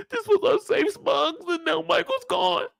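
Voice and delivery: put-on sobbing falsetto; mock crying. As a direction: imitate a tearful woman mourning, hammed up. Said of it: "She in here crying".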